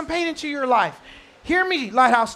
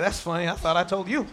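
lower, laughing reply; amused God; 6 semitones lower than usual. Retold or imitated. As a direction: chuckle it back at the parent. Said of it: "And he's like".

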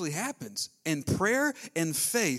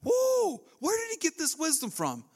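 much higher, astonished whoa; amazed crowd; 11 semitones higher than usual. Retold or imitated. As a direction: gasp it as an amazed townsman. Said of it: "They were all excited".

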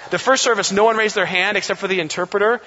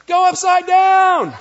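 much higher and louder slow shout; kid yelling; 13 semitones higher, 8 dB louder, slower than usual. Retold or imitated. Boy at camp yelling at the girl on the zip line, loud shout. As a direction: holler it across the camp.

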